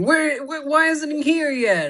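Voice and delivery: high, loud, hammed-up impatient whine; mock whining. Podcaster acting out the character whose contact is late, mock whining. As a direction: push the pitch up and complain loudly. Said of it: "he's bitching and whining 'cause the dude's late".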